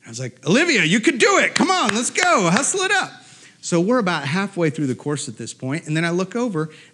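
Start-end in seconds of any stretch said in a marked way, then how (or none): 0.5-3.2 high, punchy dad cheer; urging cheer. Retold or imitated. Dad to daughter in the race, imitated urging cheer. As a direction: push her on with a high call.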